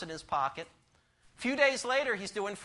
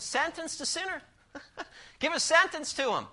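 Sentence the sinner said much higher, punchy; restating the blunt point, pitched up; higher repeat; 8 semitones higher than usual. Shown as a demonstration. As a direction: repeat the point up high for effect. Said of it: "That's straight, strong, isn't it?".

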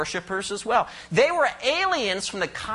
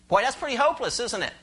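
normal talking voice

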